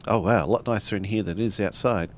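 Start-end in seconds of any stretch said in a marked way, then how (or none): none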